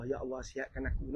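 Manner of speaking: low, hushed plea to God; pleading prayer. Acted. A sick person begs Allah for health, soft pleading. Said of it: "kita merayu pada Allah".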